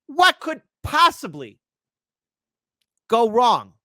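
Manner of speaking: high, drawn-out mock question; mock-innocent question, loud and slow; loud sarcasm. Real, not acted. Sarcasm about chemicals near lake.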